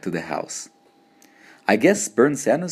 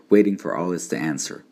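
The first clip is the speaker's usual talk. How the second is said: plain reading voice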